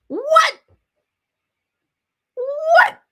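high, loud, baffled What?; can't believe it, pitch leaps; shocked disbelief; incredulous what. Real, not acted. Baffled by accent claim.

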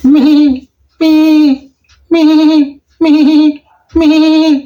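very high, short quavering bleats; small goat bleat. Shown as a demonstration. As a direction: bleat like a little kid goat. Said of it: "ചെറിയ ആട് കരയുമ്പോഴുള്ള ശബ്ദം".

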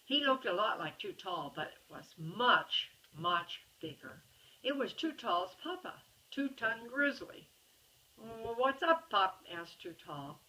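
storybook voice, characters lightly voiced; reading to a child. A narrator's reading. Parent reading a bedtime story to a child, lively storybook reading. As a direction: read the picture book aloud to a little one. Reads picture book to child.

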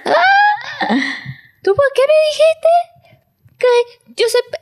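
put-on very high, slow, wounded child's cry; hurt little boy. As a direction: gasp it out like a heartbroken kid. Son's hurt reaction imitated. (niño dolido, muy agudo y lento)

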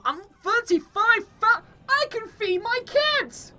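hammed-up joyful cry; mock triumph. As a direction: play it like a miracle. Joking mock poverty triumph.

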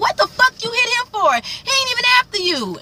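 can't believe he just hit the guy; outraged disbelief. Retold or imitated. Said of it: "I'm like".

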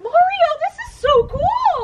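high, loud gushing; gushing admirer. Acted. Admirer gushes to Mario about the ship, high and excited. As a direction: gush over it, pitch way up.

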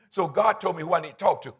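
normal talking voice

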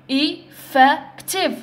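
"Effective" slowly as e, fec, tive; split into syllables. Shown as a demonstration. Teacher letting learners hear three sounds, "Effective" syllable by syllable. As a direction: give "Effective" three separate, measured beats. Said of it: "رانا نسمعوا في ثلاث أصوات".

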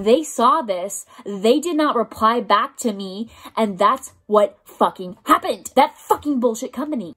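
real anger, loud and emphatic; loud, fed-up outrage; livid. Real, not acted. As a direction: rant it, punching each word. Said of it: "I am livid".

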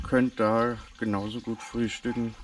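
normal talking voice

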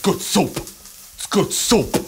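high, loud, slow punched words; Adam Driver. Acted. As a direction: savour each word with intense emphasis. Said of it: "good soup from, uh, from Girls".

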